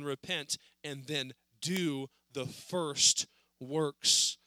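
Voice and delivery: "do the first works" drawn out very slowly; slow emphasis. Shown as a demonstration. Preacher pressing the verse on the congregation, very slow. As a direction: stretch "do the first works" word by word. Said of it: "the Bible says".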